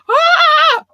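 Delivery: high, loud shriek; mock panic scream. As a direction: scream like someone with nowhere to run. Imagined person screaming at meteor.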